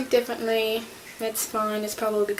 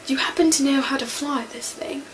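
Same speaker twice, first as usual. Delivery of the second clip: Twelfth; 2 semitones higher, 4 dB louder than usual. Acted. Fan quotes the new Doctor in a crashing ship, startled role-play. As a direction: burst out amazed, then try to stay calm. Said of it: "Twelfth's first words".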